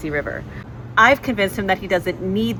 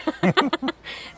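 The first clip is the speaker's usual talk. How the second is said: giggle; 7 semitones higher than usual. Real, not acted. Giggling before the surprise reveal.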